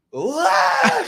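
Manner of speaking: high, very loud retold scream; screamed warning. Snowboarder warning a lady where he's going, screamed. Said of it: "screaming, like, I'm letting her know".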